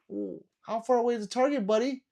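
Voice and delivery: high, loud mock-curious jab; mocking question. Streamer taunting YouTubers who hide target distance, high mocking question. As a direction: needle them with a high, mocking ooh. Mocks the YouTubers he rants about.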